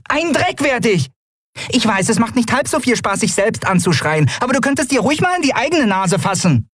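high-pitched cheeky retort; defiant Nelen. Acted. Nelen refusing to stay out, high defiant retort. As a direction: talk back in a very high voice. Nelen argues back at Keru.

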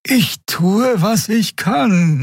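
high, drawn-out muttered reply; strained high murmur. A narrator's reading. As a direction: mutter it slowly, pitched high. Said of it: "murmelte sie".

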